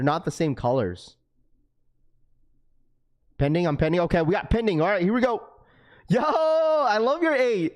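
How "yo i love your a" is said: excited shout-out. Real, not acted. Reacting to a viewer's name.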